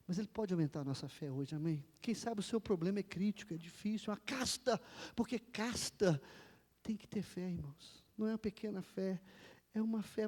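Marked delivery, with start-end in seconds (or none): none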